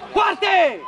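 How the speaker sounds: sharp sideline call; shouted name. Real, not acted. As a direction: shout the name across the pitch. Coach calls a player.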